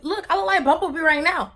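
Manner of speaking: high, loud playful boast; excited joke. Real, not acted. Joking to viewers about looking like Bumblebee, high and excited. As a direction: show off like a cartoon hero.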